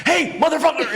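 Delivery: high, loud furious yell; retold angry shout. Dad yelling at the man grabbing his son, loud high shout. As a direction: bellow at the stranger. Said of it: "He's like".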